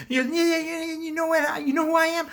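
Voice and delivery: very high, loud sputtering rant; hammed-up indignant stammer; mock outrage. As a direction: argue back in a worked-up squeal. Acts out Joker's escalating quarrel.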